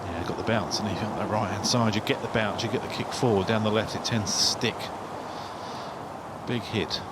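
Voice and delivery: ordinary talk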